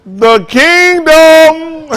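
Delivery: drawn-out shout. Real, not acted. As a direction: stretch "The Kingdom" long and loud, high above the talk. Preacher calls out the kingdom.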